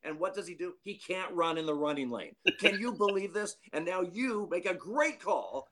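high, exasperated manager ranting; mock a fuming manager throwing his arms up; animated manager rant. Manager venting to the umpire, high and exasperated. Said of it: "Kelly's being very animated".

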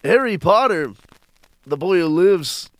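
loud, high animated comedian voice; big animated impression, high and loud; Chuck Fury impression. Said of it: "You sound like a drunk Christopher Walken".

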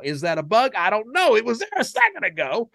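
high, loud, jittery mock guard; paranoid rambling. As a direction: rattle it off like a twitchy, paranoid guard.

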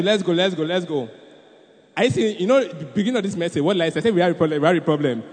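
ordinary talk, nothing marked

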